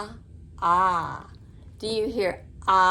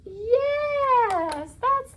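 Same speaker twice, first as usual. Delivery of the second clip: excited praise; 12 semitones higher than usual. Real, not acted. Praising a child's answer.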